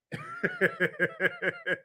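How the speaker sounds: a high burst of laughter at his own joke. Real, not acted. Joking about marrying a rich man, high laugh.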